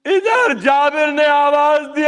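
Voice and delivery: rising, shouted mourning oratory; impassioned majlis preaching. Acted. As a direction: preach the grief out at full force. Majlis preaching of Jabir at Karbala. (پرجوش مجلس خطابت، بلند آواز میں)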